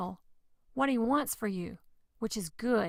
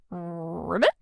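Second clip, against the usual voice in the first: croaking ribbits like a playful frog; children's sermon frog croaks; 3 semitones lower, much slower than usual. Pretend.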